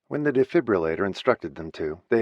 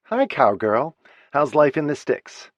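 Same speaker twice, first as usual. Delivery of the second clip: bright, louder, playful teasing; 7 semitones higher, 5 dB louder than usual. Acted. Actress sister teasing Kate on the phone, loud and playful. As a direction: rib her little sister. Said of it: "Gemma teased her".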